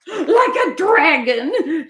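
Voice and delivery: high, slow dramatic shout. A narrator's reading. Raccoon scaring Duck about the dragon, high slow shout. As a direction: boom out the dragon to scare. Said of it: "shouted Raccoon".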